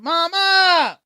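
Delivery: high call across the house; calling mama from another room. Real, not acted. Calling mom to the camera.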